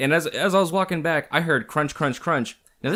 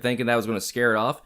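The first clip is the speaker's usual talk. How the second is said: usual speaking voice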